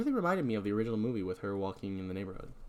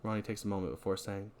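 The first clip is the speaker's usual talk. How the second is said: ordinary talk, nothing marked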